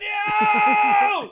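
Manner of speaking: very high, loud cry of dismay; drawn-out wail. Real, not acted. Host bemoans soaked pants, howling wail. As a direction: howl the word in protest. Just spilled beer on himself.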